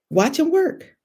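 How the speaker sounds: high, lifted with a rising end; an exclamation of praise that climbs on work; excited rise. Acted. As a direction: sing it upward with joy. Refrain repeated, climbing.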